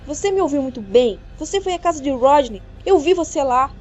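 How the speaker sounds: alto e firme, mais agudo; acusação furiosa. A narrator's reading. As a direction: acuse a noiva sem recuar. Acusação em briga. (acusação furiosa e alta)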